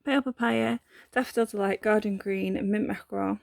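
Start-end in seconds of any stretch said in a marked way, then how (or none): 2.7-3.4 put-on a light French accent on Mint Mackerel; French-tinged colour name. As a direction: slip lightly into mock French. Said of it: "do a French accent every time".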